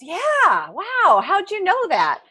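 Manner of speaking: high, swooping mock surprise; acted amazement. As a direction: play the astonished man in a joke. Joke character reacts to a guess.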